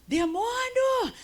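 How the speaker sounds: retold yell at demon. Calling out the demon in her own home, high and stretched. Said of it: "já fui gritando pro inferno".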